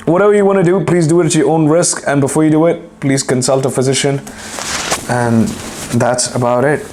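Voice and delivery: ordinary delivery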